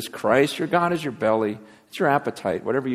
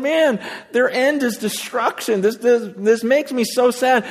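higher, slowed, pleading lament; imitated sorrow; 10 semitones higher, 3 dB louder, slower than usual. Preacher voices Paul weeping over the lost, imitated sorrow. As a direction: act grieved without really crying. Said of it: "and he's crying".

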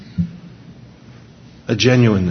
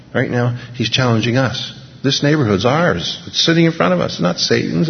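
ordinary talk, nothing marked

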